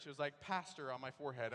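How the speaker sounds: ordinary delivery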